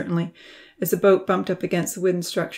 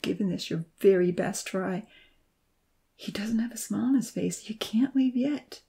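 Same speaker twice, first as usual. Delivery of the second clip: inner voice; 3 semitones higher than usual. A narrator's reading. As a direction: coach yourself in a whisper. Inner voice advice.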